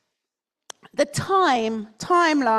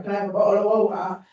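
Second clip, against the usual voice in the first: quick, dropped-T North London "bottle of water"; North London accent; 4 semitones lower, much faster than usual. Retold or imitated. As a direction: rattle it off the way a North London kid would. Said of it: "slaps around the back of the head from my mother".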